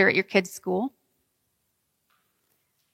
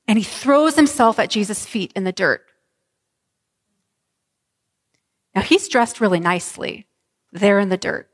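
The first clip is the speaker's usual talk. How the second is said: sermon scene-setting voice; vivid guided imagery; 3 semitones higher, 5 dB louder than usual. Acted. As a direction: paint the crowded street for them.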